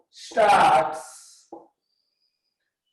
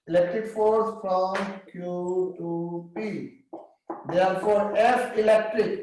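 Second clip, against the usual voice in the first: words spaced out for students to write down; dictating each phrase slowly so the class can copy it; slow dictation; much slower than usual. Shown as a demonstration. Teacher dictating notes to students, slow and spaced.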